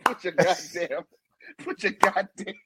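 helpless laughter. Real, not acted. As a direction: keep restarting, too tickled to finish. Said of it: "I'm in tears".

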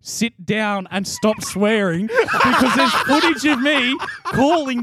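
laughing through the words, slow; laughing while retelling. Retold or imitated. Mates hear about his mum's text, laughing. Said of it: "And she goes".

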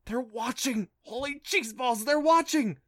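high, panicky whisper-shout; alarmed and rising in pitch; panicked. Acted. Clancy panics about parents watching.

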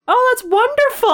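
sweet high-pitched customer voice; high mock French man. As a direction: gush it in a high delighted voice. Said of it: "And he's like".

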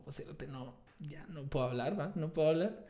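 half-whispered, croaky plea with hardly any voice; voice gone. Retold or imitated. Telling Esteban he can't speak, in a croaky half-whisper. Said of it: "le dije al Esteban así como".

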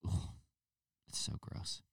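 whispered, grossed-out groan; disgusted whisper. Retold or imitated. Onlooker muttering disgust at the cooing couple. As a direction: breathe out your disgust under your breath.

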